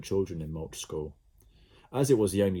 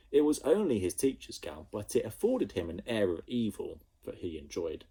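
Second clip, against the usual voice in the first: usual speaking voice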